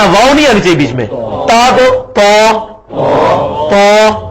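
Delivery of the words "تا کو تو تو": "تو" said slowly with a thick, rounded Arabic ṭa; heavy Arabic ta. Shown as a demonstration. Teacher models the heavy Arabic letter for students, slow and thick. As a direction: stretch out "تو" with the tongue rounded and heavy. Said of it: "تا کو ہی تھوڑا سا موٹا کر کے".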